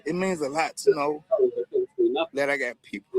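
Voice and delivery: choked up, voice lifting then failing; overcome, halting with long pauses; emotional. Real, not acted. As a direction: stop mid-thought, fighting back real tears. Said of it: "I hate to be an emotional guy like this".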